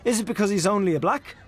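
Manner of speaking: Freddy pushing the dinner table, sharply. A narrator's reading. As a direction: press the point with an edge. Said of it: "asked Freddy Malins sharply".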